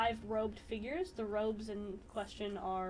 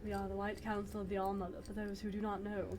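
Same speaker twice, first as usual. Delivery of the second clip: deep voice; 5 dB quieter than usual. Acted. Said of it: "it's a very deep voice".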